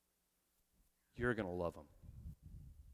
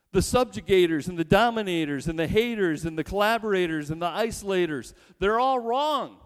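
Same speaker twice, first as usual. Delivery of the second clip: preaching shout; 5 semitones higher, 8 dB louder than usual. Real, not acted. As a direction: thunder it from the pulpit. Preacher builds to a climax.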